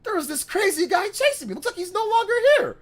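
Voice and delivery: high, rushed telling; panicked relief. Retold or imitated. Kid telling his friend about the chaser, high and fast. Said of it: "I'm like".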